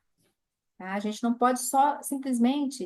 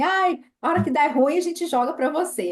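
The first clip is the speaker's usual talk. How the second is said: high, loud playful voice; high joking imitation of the women; mock carefree followers; 5 semitones higher, 6 dB louder than usual. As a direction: toss it off like a lazy helper. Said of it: "elas falam assim".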